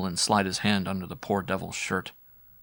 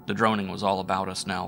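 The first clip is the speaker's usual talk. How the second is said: ordinary delivery, nothing marked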